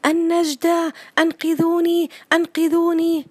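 put-on high, loud panicked child cries; a boy shrieking for help in fear; child screaming for help. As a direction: scream for rescue like a frightened boy. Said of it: "يسمع صوت طفل يصرخ".

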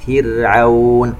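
"فرعون" slowly, kasra on fā given fully so rā stays light; full kasra on fā. Shown as a demonstration. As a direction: fill the fā's kasra in "فرعون". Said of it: "نشبع الكسرة التي على حرف الفاء". ("فرعون" بإشباع كسرة الفاء وترقيق الراء)

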